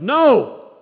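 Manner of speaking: loud, high, explosive no; yelled. Retold or imitated. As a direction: shout it in disbelief. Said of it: "He yelled".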